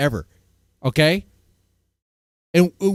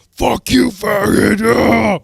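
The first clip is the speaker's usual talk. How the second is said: loud mock shout, ending in a roar; gamer yell; 3 semitones higher, 7 dB louder, slower than usual. Gamer yelling at his teammate, loud mock shout. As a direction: scream at a teammate over the headset.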